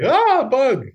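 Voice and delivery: high, loud imitation shriek; comic replay of a startled yelp; mock panic. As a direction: shriek it in hammed-up alarm. Replays the couple's freak-out.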